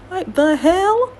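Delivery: baffled, can't believe what he just heard; incredulous. Real, not acted. Reacting to homeboy promising money in three days, incredulous and drawn out.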